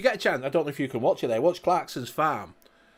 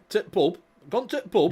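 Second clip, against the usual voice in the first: broad Yorkshire, higher pitched; Yorkshire accent; 4 semitones higher, faster than usual. Shown as a demonstration. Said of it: "that's Yorkshire".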